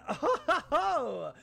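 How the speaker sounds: high, relieved victory chuckle; happy laugh. Real, not acted. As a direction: laugh it out after a win. Laughs after winning.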